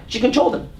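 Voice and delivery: ordinary talk, nothing marked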